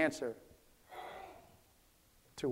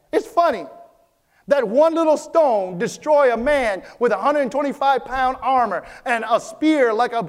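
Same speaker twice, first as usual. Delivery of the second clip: louder, climbing sermon cadence; full-force preaching; 5 semitones higher, 7 dB louder than usual. Acted. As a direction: preach it out to the congregation, building.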